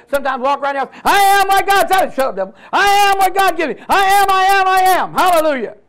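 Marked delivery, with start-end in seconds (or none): 1.1-5.1 shouted at full force, much higher; preacher shouting his faith aloud to the room; screamed declaration. Shown as a demonstration. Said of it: "You need to scream that". 5.1-5.8 loud, high cry of praise; exultant hallelujah. Real, not acted. Preacher exults after shouting.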